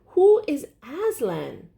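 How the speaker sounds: high and slow, full of wonder; excited question. A narrator's reading. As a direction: ask in breathless wonder. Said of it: "cried Lucy with a shiver".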